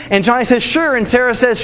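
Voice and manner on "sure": put-on high bright kid answer; a quick, high kid's yes; eager kid.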